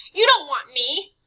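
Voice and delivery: high, loud tearful pleading; boy sobbing to the vampire. Acted. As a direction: sob it out in fear. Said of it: "he sobbed".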